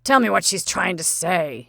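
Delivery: put-on a loud, gruff old man's demand; stern old colonel. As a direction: growl it like an impatient old colonel.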